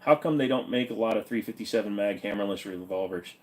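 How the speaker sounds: normal talking voice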